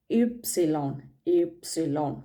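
ഇപ്സിലോൺ said as German "Ypsilon"; German letter name. Shown as a demonstration. Teacher modelling the German name of Y. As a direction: say Y as ഇപ്സിലോൺ, ip-si-lon. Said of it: "ഇപ്സിലോൺ എന്നാണ് pronunciation".

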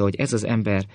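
ordinary delivery, nothing marked

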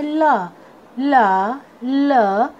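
ला as a lone "luh", repeated; phonic letter sound. Shown as a demonstration. Teacher showing students the sound of L, phonic letter sound. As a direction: sound out ला as L starts "lion". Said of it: "एल का sound होता है".

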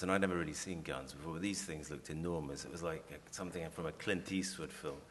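ordinary delivery

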